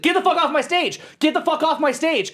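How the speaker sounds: high, loud furious yell. Retold or imitated. Sound guy chasing a frat guy offstage, furious high yell. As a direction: roar it at the intruder. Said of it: "He's like".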